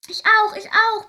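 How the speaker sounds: high, loud excited begging; little angels pleading eagerly with their teacher; begging children. A narrator's reading. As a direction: clamour to be next. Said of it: "betteln die anderen Engelchen".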